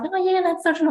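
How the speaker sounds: high, dismissive mock voice; mimicking the group's dismissal, pitched high; mocking group member. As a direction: brush it off in a high voice.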